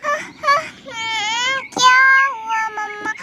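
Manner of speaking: put-on tearful little kid, voice breaking after a fall; crying child. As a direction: whimper it out like a hurt child. Child fell off bed crying. (गिरने के बाद रोता हुआ बच्चा)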